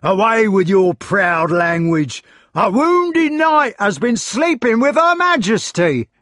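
high, loud angry yell. A narrator's reading. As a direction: bark him down. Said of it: "yelled Sir Meleagrance".

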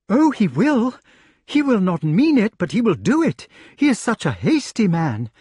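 high, loud fretful lady's voice; lady's pleading voice; anxious lady. Acted. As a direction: plead like a frightened noblewoman. Lady voiced in read story.

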